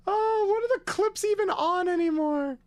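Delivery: squeaky high complaint; high put-on voice. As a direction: pop way up high. Sudden high voice.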